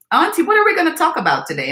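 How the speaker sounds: put-on high, quick little-girl voice; imitating her niece asking brightly; niece's voice. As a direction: chirp it like an eager five-year-old. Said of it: "She said to me".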